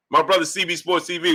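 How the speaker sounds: usual speaking voice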